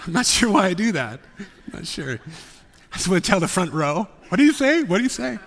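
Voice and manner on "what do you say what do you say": playful call-out. Pretend. Teasing call to front row.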